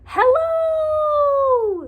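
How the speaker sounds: high loud drawn-out call; high long hello across the water. Acted. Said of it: "he called".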